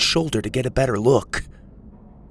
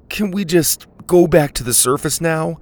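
begging; 6 semitones higher, 3 dB louder than usual. A narrator's reading. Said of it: "Dylan begged".

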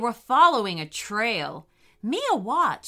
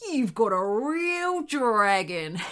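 drawn-out, sneering amusement; mock their claim slowly, on the edge of laughter; chuckling dingo; 4 dB louder, slower than usual. A narrator's reading. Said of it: "chuckled the lead dingo".

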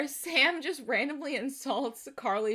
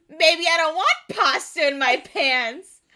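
loud, raised-pitch mock protest; hammed-up comeback, louder and higher; indignant outburst; 4 semitones higher, 9 dB louder than usual. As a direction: retort hotly, half laughing. Acts out Freddy's indignant comeback.

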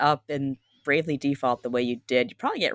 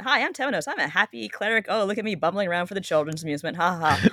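high, bumbling cheerful priest voice with "Temenos" in three syllables, TEM-e-nos; hammed-up sunny cleric ending in a fake laugh; goofy happy cleric; 3 semitones higher than usual. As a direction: play the harmless jolly cleric.

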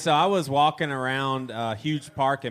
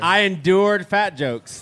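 mock solemn; 5 semitones higher, 7 dB louder, slower than usual. Deadpan joke answer, slow and loud.

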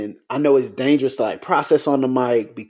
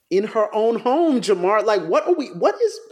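disbelief; 10 semitones higher than usual. Real, not acted. Outrage over police killing.

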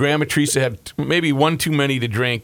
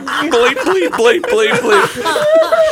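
rising laughing bleeps, louder and higher; mock cussing; 5 dB louder, much slower than usual. Uncle cussing left and right, laughing through it. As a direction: bleep the curses while cracking up. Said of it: "he was cussing left and right".

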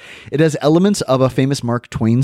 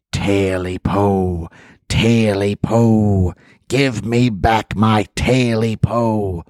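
creature voice; much slower than usual. Acted. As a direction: drag it out like a hungry creature. Creature at the window.

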